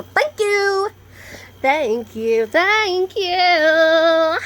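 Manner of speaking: high, loud singsong gratitude; sarcastic gushing thanks. Pretend. Vlogger thanks hateful commenters for the algorithm boost, sarcastic gushing. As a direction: build to a long, high, drawn-out flourish.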